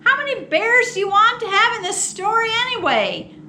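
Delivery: high, loud and fast, fed up; exasperated outburst. Acted. Narrator fed up with kids shouting for bears, loud exasperated outburst. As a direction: snap back at the hecklers, then rattle off the question. Author snaps at interrupting children.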